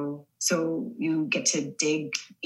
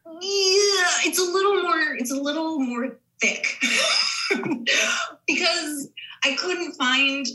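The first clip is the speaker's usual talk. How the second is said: laughing; 10 semitones higher, slower than usual. Real, not acted. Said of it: "laughing at Georgian accent".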